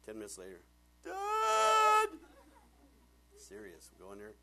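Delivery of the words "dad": long, high imitated kid's scream; child yelling for dad. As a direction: holler like a stuck four-year-old. Imitates daughter stuck in shirt calling.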